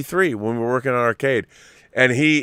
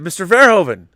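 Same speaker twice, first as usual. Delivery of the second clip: higher, louder call from a car; 8 semitones higher, 6 dB louder than usual. Retold or imitated. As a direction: yell a name across the street. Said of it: "'cause I had yelled his name".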